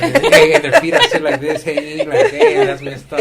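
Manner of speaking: high, loud words through laughter; can't stop laughing while telling it; laughing hard. Real, not acted. Telling a friend about the tiny couple, laughing hard.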